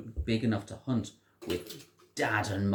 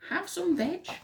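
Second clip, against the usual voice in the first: hooted much higher, offering food; a kindly duckbill call. A narrator's reading. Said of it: "And they hooted".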